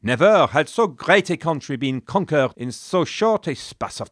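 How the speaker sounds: put-on grand, lofty reading of an old chronicle; proud chronicler. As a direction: declaim it like a proud medieval chronicler. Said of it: "As the chronicler Jean Cartier wrote".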